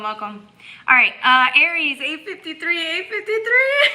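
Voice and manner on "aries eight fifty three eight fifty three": laughing. Real, not acted. Reading a time, laughing.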